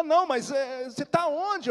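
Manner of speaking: high, loud, imitated mother; frantic questioning. Mother on phone to lost son, frantic questioning. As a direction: fire off disbelieving questions. Mother insists on knowing where.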